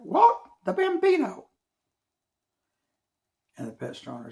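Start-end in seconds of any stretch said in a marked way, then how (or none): 0.0-1.5 very high, loud bird squawk. Acted. Bird squawking at the name Babe Ruth. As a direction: screech it like an amazed parrot.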